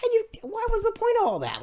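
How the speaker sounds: a high mock cry of what was the point; incredulous outburst. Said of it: "I'm like".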